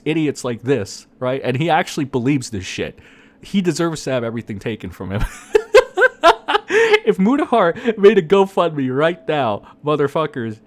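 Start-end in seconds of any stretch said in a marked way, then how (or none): 5.3-7.0 long, high, loud laugh. Real, not acted. Host relishing a lawsuit against Gerard, laughing loudly. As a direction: burst into gleeful laughter. Laughing at taking everything from him.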